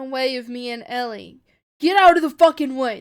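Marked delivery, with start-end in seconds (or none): 1.8-3.0 very loud, raised-pitch command; furious shout. A narrator's reading. Soldier shouting at Joel who blocks him, furious shout. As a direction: roar the order at him. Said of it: "the soldier shouts at him".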